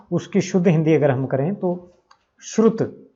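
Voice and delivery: ordinary talk, nothing marked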